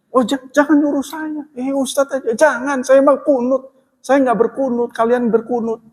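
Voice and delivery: flustered polite refusal. Retold or imitated. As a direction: wave off the honour, flustered. Congregant declining to lead prayer.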